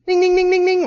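alarm bell imitation. Alarm bells went off.

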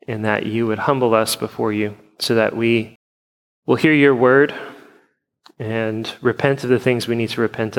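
measured, subdued pastoral prayer; congregational prayer. Acted. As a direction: pray evenly for your church, pausing between petitions.

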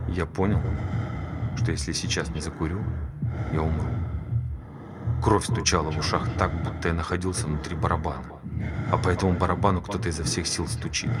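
low, soft, hushed telling; almost at a whisper, drawing listeners in; hushed confession. Acted. Hushed climax of the story. (тихо, приглушённо, почти шёпотом)

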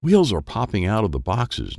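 plain reading voice

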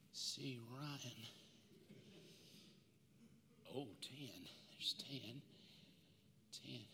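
soft, low murmured names; softly naming sins in the sand; quiet musing. Acted. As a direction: say each name softly to yourself as you write. Jesus writes sins in sand.

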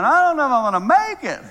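high, loud, hurried mock exasperation; imitating overwhelmed grandmother. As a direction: act out an overwhelmed phone call. Jane calls overwhelmed by meltdowns.

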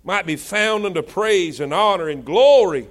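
rising, pounding pulpit preaching; preaching at full force. Acted. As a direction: drive the verse home to the congregation. Preacher builds over scripture.

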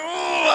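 high mock whimper of held-back tears; hammed-up, high suppressed wail; stifled crying noise. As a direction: imitate ugly trying not to cry. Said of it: "ugly trying not to cry".

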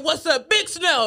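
drawn-out teasing call of Big Snow, breaking into laughter; mock hype shout. Mocking the DJ who hyped up the crowd, slow and laughing. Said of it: "why were you so in it?".

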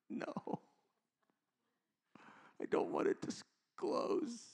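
hushed mock refusal, almost inaudible; tiny, slow, imitated reluctant voice; reluctant congregant. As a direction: whisper it as a timid person refusing. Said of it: "Yeah, some people".

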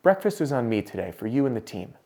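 quiet imitation of a discreet whisper; retold whisper. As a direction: say it softly, as into someone's ear. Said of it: "whispers in my ear".

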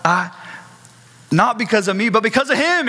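mild shaky stammer that rises into hope; a touch of trembling nerves; trembling pastor. Acted. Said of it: "he's trembling and he's shaking".

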